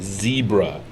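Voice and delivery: "zebra" said as ZEE-bruh; first syllable of "zebra" rhymes with see; long-e zebra. Shown as a demonstration. Man quoting how he says it in the States, "zebra" as ZEE-bruh. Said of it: "only time I've ever said".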